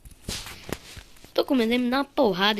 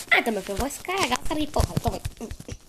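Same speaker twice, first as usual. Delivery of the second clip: fast mock threat; faster than usual. Taunting the enemy in game.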